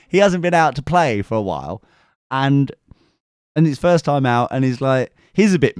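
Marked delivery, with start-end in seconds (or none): none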